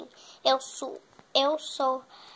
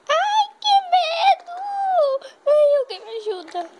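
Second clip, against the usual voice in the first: terrified cry; 13 semitones higher, 8 dB louder than usual. Acted. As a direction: cry out for help in panic. Scared of the ugly girl. (grito apavorado e agudo)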